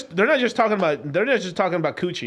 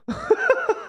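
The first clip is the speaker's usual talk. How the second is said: high-pitched laugh; high chuckling laughter. Real, not acted. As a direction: burst into a high laugh at your own joke. Laughs at own croissant joke.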